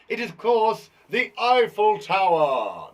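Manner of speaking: loud, slow, drawn-out announcement; stretched-out, showy quiz answer; grand reveal. Pretend. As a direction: boom out the answer with a flourish.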